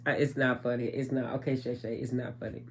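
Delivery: quietly composing herself. Real, not acted. Composing herself after laughing fit.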